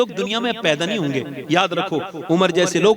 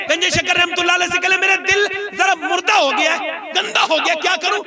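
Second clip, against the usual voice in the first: higher voice of a worried disciple; pleading seeker; 9 semitones higher than usual. Retold or imitated. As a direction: beg the saint for help. Preacher voices pleading visitor.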